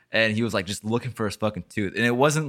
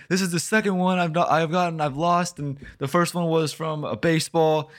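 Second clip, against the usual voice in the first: put-on crying kid; 9 semitones higher, 4 dB louder than usual. Kid complaining to the dad about his tooth, high whimper. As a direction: whine like a hurt little boy tattling. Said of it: "He's like".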